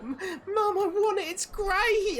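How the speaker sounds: put-on very high, loud pleading kid voice; squealing child wanting a toy; whiny child. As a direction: beg like a small child desperate for a toy. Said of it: "the children are like".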